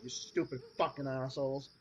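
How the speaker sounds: ordinary talk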